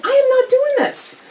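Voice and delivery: high-pitched mock-indignant protest; acted indignant refusal. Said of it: "And so I said".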